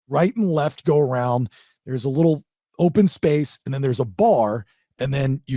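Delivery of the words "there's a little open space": usual speaking voice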